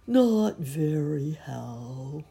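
slow, dejected drone; mournful, drawn-out reply; gloomy donkey. A narrator's reading. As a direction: answer slowly, sinking into gloom. Gloomy Eeyore answers Pooh.

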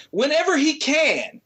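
ordinary talk, nothing marked

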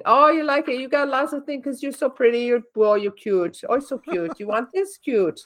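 high, gushing sales pitch in mock accent; cooing shop lady with put-on accent; store-clerk imitation. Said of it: "she's got this accent".

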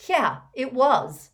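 put-on boy basking in Dumbledore's praise; proud. A narrator's reading. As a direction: relish the praise. Malfoy taking pride in praise.

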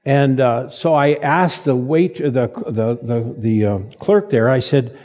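ordinary talk

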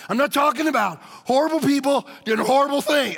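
usual speaking voice

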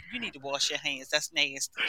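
hushed mom. Retold or imitated. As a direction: say it under your voice, covering up. Mother retells her quiet cover-up.

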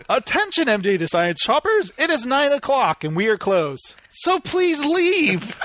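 goofy announcer who tells shoppers to leave; cheeky intercom announcer drawing out the last words; mock store announcement. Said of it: "Why don't you do the store announcements".

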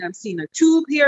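ordinary talk

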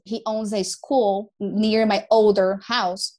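ordinary talk, nothing marked

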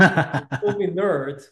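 slow words through a laugh. Real, not acted. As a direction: laugh while you say it. Joking about being a nerd.

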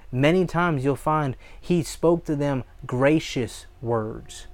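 normal talking voice

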